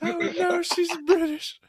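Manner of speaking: Gerald's panic. Acted. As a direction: squeak it in horrified panic. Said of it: "This is Gerald's reaction".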